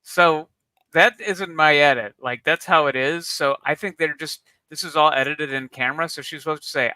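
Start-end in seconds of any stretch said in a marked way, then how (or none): none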